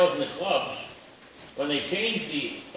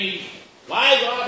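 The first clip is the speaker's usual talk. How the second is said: ordinary talk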